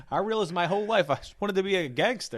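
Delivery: high loud put-on voice; impression of him. Quoting his bit in a loud put-on voice. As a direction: belt it out in his character voice. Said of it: "He's like".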